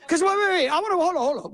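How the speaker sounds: high, pressing wait wait; urgent interruption. Real, not acted. Governor cutting in on reporters, high and urgent. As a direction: jump in urgently to stop the room.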